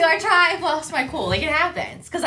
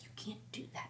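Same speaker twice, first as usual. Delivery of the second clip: husband's hushed warning, barely voiced; whisper; 25 dB quieter than usual. Husband whispering to his wife in the car. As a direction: whisper it so the kid won't hear.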